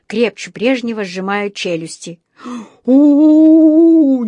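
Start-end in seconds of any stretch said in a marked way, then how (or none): 2.8-4.2 high, very loud drawn-out howl; frantic yell. A narrator's reading. Dentist with his finger caught, yelling wildly. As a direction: bellow in pain, not your own voice. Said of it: "не своим голосом заорал".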